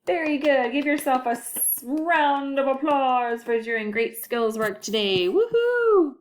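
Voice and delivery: excited teacher praise; cheery praise. Acted. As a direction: cheer the kids on.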